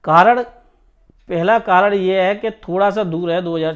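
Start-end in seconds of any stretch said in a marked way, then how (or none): none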